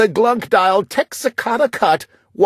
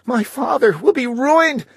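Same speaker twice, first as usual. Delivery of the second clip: frightened; 12 semitones higher than usual. A narrator's reading. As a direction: panic rising, voice up high. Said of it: "My sister shook with fright".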